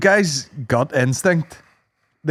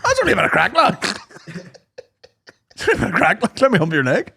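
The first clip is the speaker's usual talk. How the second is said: mock replay; 5 semitones higher, faster than usual. Replaying the scene.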